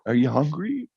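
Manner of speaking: a hesitant, careful question; tentative. Retold or imitated. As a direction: ask it unsure, testing the water.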